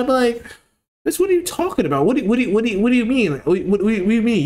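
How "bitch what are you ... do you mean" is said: high-pitched indignant sputter, stumbling over the words; mock mad. Imagined comeback to a cashier, mock mad. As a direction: play outraged disbelief in a raised pitch. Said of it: "I'd be so mad".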